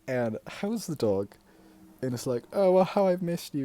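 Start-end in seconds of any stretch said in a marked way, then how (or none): 2.5-3.6 loud, high, silly gushing; silly doting uncle. Retold or imitated. Uncle greeting his Pomeranian, silly and doting. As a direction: coo loudly at the dog, playfully. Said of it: "Paul being silly as he is".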